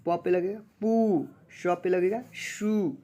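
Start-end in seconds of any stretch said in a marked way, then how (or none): 0.8-1.3 पू stretched long on its vowel; पू held long, falling at the end; drawn-out ū. Shown as a demonstration. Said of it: "पढ़ेंगे खींच के". 2.3-3.0 शू stretched slowly on its vowel; शू held long, falling at the end; drawn-out ū. Shown as a demonstration. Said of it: "पढ़ेंगे खींच के".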